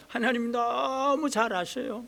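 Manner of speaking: ordinary talk, nothing marked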